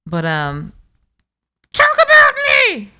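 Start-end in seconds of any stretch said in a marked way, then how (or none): 1.7-2.9 very high, squeaky puppet voice; Lexi. Acted. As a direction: cut in as an indignant squeaky character. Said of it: "Hi, I'm Lexi".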